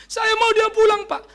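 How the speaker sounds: high, loud mock pleading; hammed-up wifely plea. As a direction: act a wife begging the pastor for help. Acts out pleading wife.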